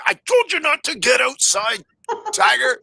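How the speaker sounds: high-pitched pretend telling-off; mock scolding owner. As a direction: scold a runaway pet, hammed up. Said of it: "Buddy comes out and yells".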